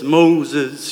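deep, booming call of God from the bush; Charlton Heston voice. Retold or imitated. Pastor imitating God calling Moses in a deep booming voice. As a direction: boom the name out low like a movie God. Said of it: "in that Charlton Heston voice".

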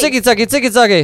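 loud, high call-out to the crowd; rally the room into the cheer; party cheer. Acted. Host rallying co-hosts into the Zicki Zacki cheer, loud and high.

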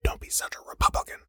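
sharp, very soft whispered rebuke; hissed whisper. Retold or imitated. Brother to narrator, telling him to shut up: hissed whisper. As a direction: hush him fast before the man hears. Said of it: "Patrick hissed".

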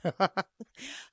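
amused chuckle at own story; laughing. Retold or imitated. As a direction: laugh while retelling. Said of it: "And I'm laughing".

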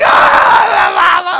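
put-on high wailing childish whine; mock crying kid. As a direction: imitate a kid running off crying. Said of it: "It's like".

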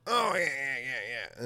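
high, loud start, quickly dropping; addled dealer. Retold or imitated. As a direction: mimic a spaced-out eager yes. Imitating dealer's eager agreement.

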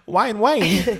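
"Ryan Wayne" said as "Wine Wayne", high and loud; garbled salon name. Retold or imitated. Nervous receptionist mangling "Ryan Wayne" into "Wine Wayne". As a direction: blurt "Ryan Wayne" as a mushy "Wine Wayne", laughing. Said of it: "I just get so nervous".

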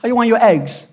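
high, loud, sing-song fake cheer; mock cheerful morning. Preacher acting out a frazzled breakfast, high and loud. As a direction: chirp it like a forced-happy spouse over breakfast. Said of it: "and you're like".

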